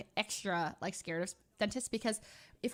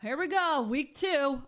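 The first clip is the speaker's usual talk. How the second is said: louder and higher, psyching up for pain; bracing herself; 3 semitones higher, 6 dB louder, slower than usual. Retold or imitated. Vlogger bracing to open painful week-two trays, louder psyched-up cry. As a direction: hype yourself up before the hurt. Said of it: "I was like".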